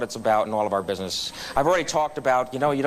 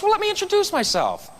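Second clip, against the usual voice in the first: high-pitched self-important retort, acted out; playful pulling of rank; mock-important introduction; 8 semitones higher than usual. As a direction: announce yourself loftily, pitched well up. Retorting to rude counter girl in anecdote.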